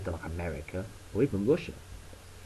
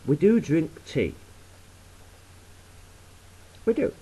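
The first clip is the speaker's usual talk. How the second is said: mock concession; 7 semitones higher, 5 dB louder than usual.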